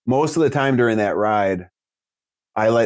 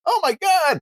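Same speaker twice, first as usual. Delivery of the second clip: amazed shout. Retold or imitated. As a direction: blurt it out in disbelief. Adult shocked by kid's age.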